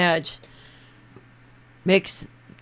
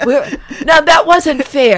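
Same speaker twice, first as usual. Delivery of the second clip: laughing; 6 semitones higher, 8 dB louder than usual. Real, not acted.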